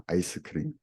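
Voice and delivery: "아이스크림", ice cream in a Korean accent. Shown as a demonstration. Teacher showing the South Korean loan word, Korean accent on "아이스크림". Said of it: "it's like saying ice cream with a Korean accent".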